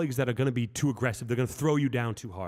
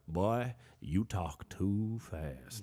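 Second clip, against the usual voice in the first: slow imitated Southern drawl, softer; 5 semitones lower, 8 dB quieter, much slower than usual. Voice teacher scolding student in slow Southern drawl. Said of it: "he'd be like".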